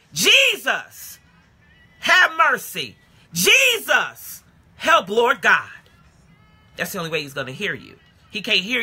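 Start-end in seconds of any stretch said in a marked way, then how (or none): 0.1-5.7 raised, pleading call for help; crying out to Jesus. Shown as a demonstration. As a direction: open your mouth and call on the Lord. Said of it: "open your mouth and say".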